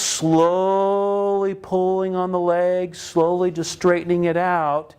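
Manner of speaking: slow, stretched-out "slowly"; long, held first syllable on "slowly"; drawn-out slowly. Retold or imitated. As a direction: draw out the word "slowly" as if easing it along. Acts out easing the leg straight.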